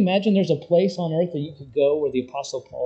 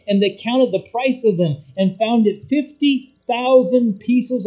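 raised, high preaching voice building with fervour; fiery preaching; 6 semitones higher, 5 dB louder than usual. Acted. Preacher stirring the church about the revival, full-force preaching. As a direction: preach it out to the whole congregation.

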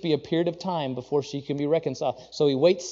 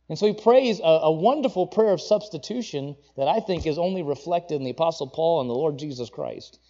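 ordinary talk, nothing marked

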